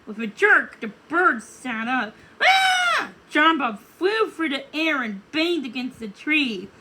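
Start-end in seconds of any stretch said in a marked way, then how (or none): 2.4-3.1 high startled yelp; a sudden startled cry; startled shout. Acted. Frog thrown back by the waking bird, startled yelp.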